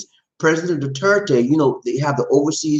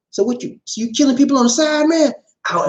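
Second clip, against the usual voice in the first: higher-pitched imitated confrontation with crooked cops; mock dressing-down, pitched up; acted telling-off; 10 semitones higher than usual. Telling crooked police they are out, imitated telling-off. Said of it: "And she tell them, she said".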